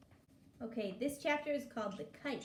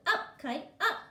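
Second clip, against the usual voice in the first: shout; 7 semitones higher, 7 dB louder than usual. Acted. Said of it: "and shout".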